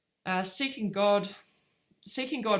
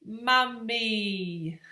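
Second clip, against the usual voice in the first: put-on higher, drawn-out toddler word; imitating toddler; 4 semitones higher, 3 dB louder than usual. Mum imitating her son looking straight at her, drawn-out toddler voice. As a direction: stretch it out like a small child. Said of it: "looked straight at me and he says".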